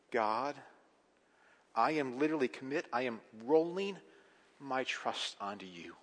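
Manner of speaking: a quiet, earnest prayer in the pastor's own voice; softly voiced prayer. Pastor voicing a believer's prayer of trust, hushed and earnest. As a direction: speak it low and sincere, like surrendering to God.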